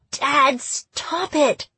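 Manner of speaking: high, sharp protest to stop; angry. A narrator's reading. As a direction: snap it out, annoyed. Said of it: "sounded angry".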